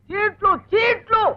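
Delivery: high, slow, drawn-out words; exasperated calling. Acted. Husband repeats slips to hard-of-hearing wife, exasperated. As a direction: stretch the word so it gets through. Repeats after she mishears.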